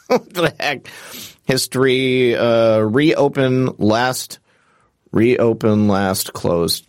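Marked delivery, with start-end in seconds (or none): none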